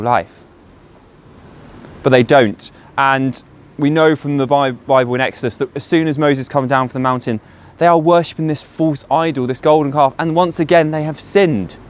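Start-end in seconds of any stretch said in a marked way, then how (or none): none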